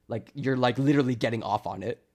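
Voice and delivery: ordinary talk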